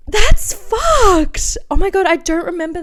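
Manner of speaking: high loud outrage after a gasp; real shocked outburst; gasping that's fucked. Real, not acted. Gasps at diary line.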